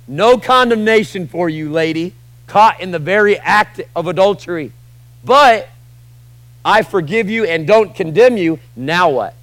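loud, high preaching push; preaching at full force. Acted. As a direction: proclaim it over the room, full voice. Preaching to the congregation.

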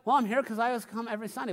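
rushed excuse. Retold or imitated. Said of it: "some of you are like".

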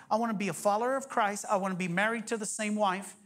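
usual speaking voice

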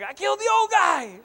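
high, loud, laughing cry; panicked through laughter; a kid sure he killed dad. Retold or imitated. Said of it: "so I'm like".

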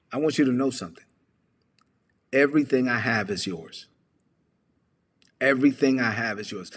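acted patient fatherly tone; calming father. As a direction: settle him with unhurried pauses. Father soothes his angry son.

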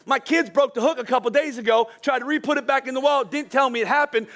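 usual speaking voice

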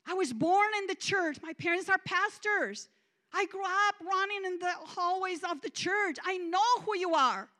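loud, high, defiant insistence; still mad. Retold or imitated. Still mad, telling God she grew up in church, loud and defiant. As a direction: insist you know him, furious. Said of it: "I was still mad and I said".

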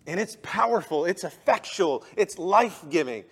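loud, high, fervent preaching; shouting sermon with passion; full-force preaching. Acted. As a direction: lift the room with fiery conviction. Preaching continues.

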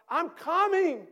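loud, high, eager rescue call; heroic shout. Acted. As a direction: charge in yelling. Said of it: "And the activist shouts".

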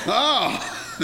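laughing oh. Real, not acted. Laughing at his own story.

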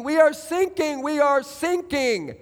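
high, urgent acted plea; pleading. Man on sinking ship begs the coast guard, pleading. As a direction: plead for rescue in a high, drawn-out cry.

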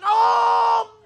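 high, loud acted cries of amazement; joyful shouting. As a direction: holler it out in mock astonishment. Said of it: "They're like, they're shout".

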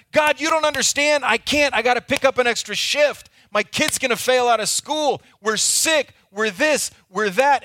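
high and loud, anxious protest; frantic excuses. Acted. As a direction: cut in with every worry. Panicked prayer, continued.

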